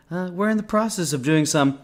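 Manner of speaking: high-pitched presidential impersonation; Trump accent. Acted. President answering reporters, in a high Trump accent. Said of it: "in a Trump accent?".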